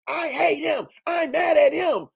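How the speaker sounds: high-pitched mock tantrum; mock mad whining. Mocking the angry guy on this panel, in a high whiny complaint. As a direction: imitate someone whining about being mad. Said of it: "I'm mad, mad".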